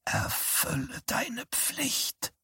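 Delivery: slow, breathless ghostly whisper; dying mother whispering her command to her son; dying mother's voice. A narrator's reading. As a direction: whisper it from beyond the grave, slowly. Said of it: "die Stimme seiner sterbenden Mutter".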